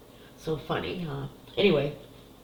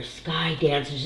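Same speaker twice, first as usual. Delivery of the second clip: ordinary talk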